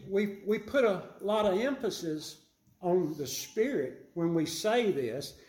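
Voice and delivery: ordinary talk, nothing marked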